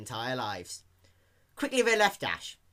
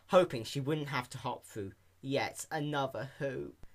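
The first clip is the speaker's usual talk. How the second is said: plain reading voice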